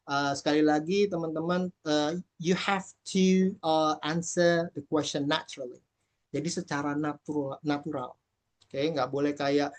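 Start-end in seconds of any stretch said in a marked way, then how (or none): none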